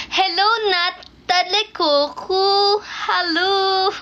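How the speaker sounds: high, drawn-out hello; playful greeting. Real, not acted. Greeting viewer Nata de Coco, high and playful. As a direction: sing out the name teasingly.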